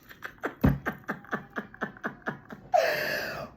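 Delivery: high airless laughter; wheezing laugh. Real, not acted. Reader wheezing with laughter at her own reading.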